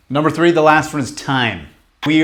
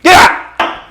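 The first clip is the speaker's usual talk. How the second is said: much higher, louder barked get out; yelling at the voice; 14 semitones higher, 6 dB louder than usual. Retold or imitated. Coach showing how he yells at his doubts, loud. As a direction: throw it out like an intruder. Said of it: "I yell at it".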